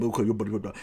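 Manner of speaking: low mock huddle gibberish; huddling apes. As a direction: drop low and jabber like an ape giving orders. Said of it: "in a huddle going".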